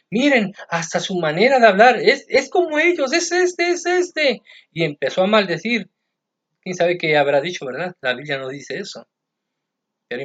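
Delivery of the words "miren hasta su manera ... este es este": very high, loud, insistent pointing voice; shrill accuser. Acted. The woman insisting to everyone that Peter is one of them, very high and loud. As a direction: keep jabbing, it's him, it's him.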